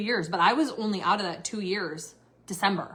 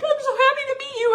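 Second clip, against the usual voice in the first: high, loud mock-sobbing fangirl; crying fan; 6 dB louder, faster than usual. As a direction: imitate an overwhelmed fan wailing with joy. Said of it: "like, crying 'cause they're like".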